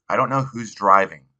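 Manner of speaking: usual speaking voice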